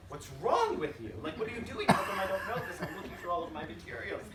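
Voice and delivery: laughing in disbelief at the CEO; incredulous, laughing. Retold or imitated. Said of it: "me in front of the C E O saying".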